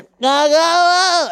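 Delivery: put-on high, slow babbling baby cheer. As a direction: chant it like a tiny baby at a show, high and drawn out. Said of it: "And you hear".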